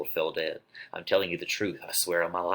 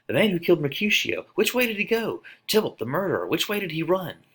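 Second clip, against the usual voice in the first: much higher, loud, urgent calling out; high shouting watchman; 11 semitones higher, 3 dB louder than usual. Acted. Citizen of the watch calling out, high and loud. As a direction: shout like a watchman hunting the killer.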